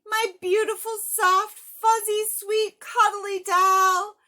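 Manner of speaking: put-on boy hugging his lost doll; gushing joy. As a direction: cradle every word with delight. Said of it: "yelled Harry happily".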